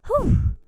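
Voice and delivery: loud, high exhaled whew; exasperated whew. Real, not acted. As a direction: blow out a big, weary whew. Exasperated before retelling worse encounter.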